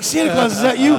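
put-on high, loud excited kid; little kid calling out; child voice. Child voice in Santa skit.

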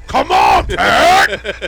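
explosive, high, very loud mock yell; imitated fed-up scream. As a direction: imitate a pestered coworker finally blowing up. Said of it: "two or three, he's like".

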